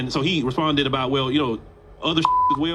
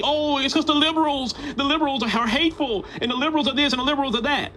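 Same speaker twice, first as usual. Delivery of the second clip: mocking imitation; 9 semitones higher than usual. Host mocking what his listeners will say, high sneering parody. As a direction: parody their excuse, voice pitched way up. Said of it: "you're gonna try to say".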